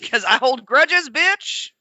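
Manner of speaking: high, loud mock defiance; Mad Russian. Imagined Russian driver explains his grudges, loud mock defiance. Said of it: "they call me the Mad Russian".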